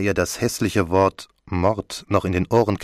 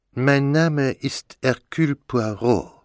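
slow, higher-pitched character voice; Poirot; 7 semitones higher, slower than usual. Acted. Poirot announces himself at the door, slow character voice. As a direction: introduce yourself slowly and grandly as the detective.

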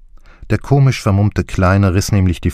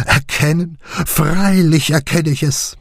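high, slow, puffed-up "Erkennen?" with a rise; proud Clancy; 9 semitones higher than usual. A narrator's reading. Clancy to the steward, proudly echoing "Erkennen". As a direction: sound pleased with yourself.

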